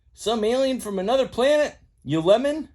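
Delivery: high, loud gloating Mater; teasing Mater. Acted. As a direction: rub it in like a prankster.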